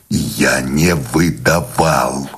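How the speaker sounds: low, very slow, squeezed-out denial; suppressed fury. A narrator's reading. Accused man denying betrayal, suppressed fury. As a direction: force each word out through contained rage. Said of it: "выдавил с приглушённой яростью".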